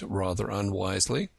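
ordinary delivery, nothing marked

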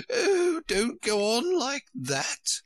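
high-pitched, drawn-out wail of a Queen; distraught Queen pleading; despairing cry. A narrator's reading. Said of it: "cried the poor Queen, wringing her hands in despair".

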